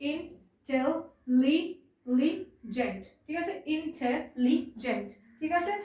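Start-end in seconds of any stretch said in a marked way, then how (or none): 0.1-3.0 "intelligent" split as in, tel, li, gent with pauses; "intelligent" in four drawn-out, detached beats; syllable by syllable. Shown as a demonstration. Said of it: "divide হচ্ছে". 3.8-5.1 "Intelligent" split into four slow syllables; "Intelligent" in four detached beats. Shown as a demonstration. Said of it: "divide হচ্ছে".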